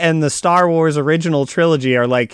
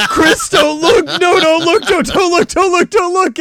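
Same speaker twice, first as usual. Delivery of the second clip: urgent pleading; 3 dB louder than usual. Retold or imitated. Wife voiced pleading in story.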